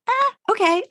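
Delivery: high-pitched, mock uncertain okay; 不知所措的附和. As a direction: squeak out a reluctant, laughing agreement. Acting out own underwhelmed reaction.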